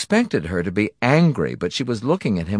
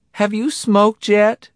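higher, slower wife voice; a higher, slow-spoken wife; wife's voice; 14 semitones higher, 5 dB louder, slower than usual. A narrator's reading. Wife asks her husband.

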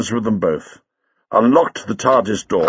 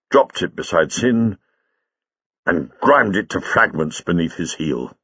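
ordinary delivery, nothing marked

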